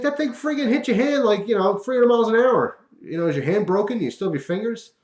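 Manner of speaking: very high, loud, rushed alarm; worried buddy, loud and shrill with worry; panicked friend. Retold or imitated. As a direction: freak out over a hand that might be broken. Mike freaks out about the hand.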